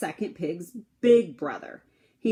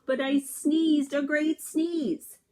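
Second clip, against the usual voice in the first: slow, higher dramatic telling; 8 semitones higher, 3 dB louder, slower than usual. Acted. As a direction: drag out the big sneeze. Wolf's second big sneeze.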